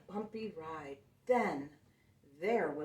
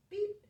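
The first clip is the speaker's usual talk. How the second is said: a quick beep, much higher; high horn beep; 13 semitones higher, 4 dB louder than usual. Acted. Truck answers the toad with a high honk. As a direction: toot a short high honk. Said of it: "said Blue".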